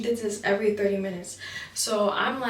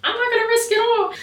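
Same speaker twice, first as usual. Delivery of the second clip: high, loud inner protest; dramatic outcry; 14 semitones higher, 6 dB louder than usual. Acted. Trainee thinking of family and purpose, high dramatic cry. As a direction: cry it out in mock desperation.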